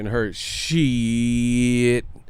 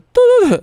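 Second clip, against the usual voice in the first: high shrieking laugh; 11 dB louder than usual. Real, not acted. Laughing fit.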